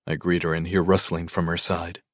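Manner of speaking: plain reading voice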